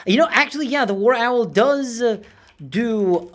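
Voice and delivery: high, loud animated burst; excited pitch swings. Acted. Realising the YouTuber talks this way too, animated high pitch. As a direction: jump up in pitch and volume. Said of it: "different pitches and emotion in his speaking".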